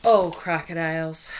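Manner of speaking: loud sweet call across the swamp. Acted. Monkey calling out to the crocodiles, loud and coaxing. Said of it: "she calls".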